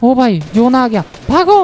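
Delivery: high-pitched cry to flee; sudden high yell; urgent warning. Real, not acted. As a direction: call out the alarm, pitch leaping. Zone closing, tells team to run.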